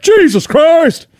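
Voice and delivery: very high, slow, loud Jesus Christ; astonished exclamation. Retold or imitated. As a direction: stretch it out in shock. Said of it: "and he's like".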